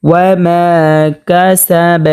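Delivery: recited ওয়া মা with মা held one beat; slow recitation stretching মা slightly; one-count elongation. Shown as a demonstration. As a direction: lengthen the vowel of মা briefly before কাসাব. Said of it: "এক আলিফ পরিমাণ টানিয়ে পড়তে হবে".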